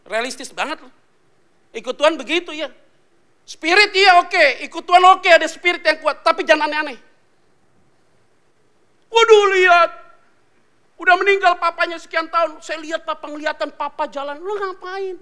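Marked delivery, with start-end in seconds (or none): 3.5-15.1 loud, high, laughing sermon push; preaching at full force. Acted. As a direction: drive it at the crowd, half joking. (khotbah keras dan bersemangat)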